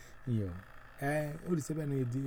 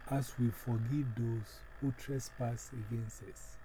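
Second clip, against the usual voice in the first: slow, solemn prayer voice with long pauses. Acted. Pastor leading his congregation in prayer, slow and solemn. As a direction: lead the congregation in prayer, unhurried.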